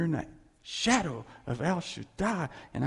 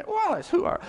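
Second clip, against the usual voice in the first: questioner; 4 dB louder, faster than usual. Retold or imitated. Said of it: "if somebody came up and asked me".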